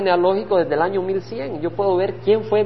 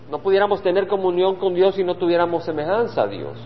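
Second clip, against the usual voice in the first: normal talking voice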